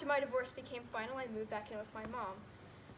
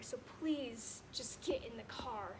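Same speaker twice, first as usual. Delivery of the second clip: weary plea; 2 semitones lower, much slower than usual. Retold or imitated. Exhausted plea after a long day.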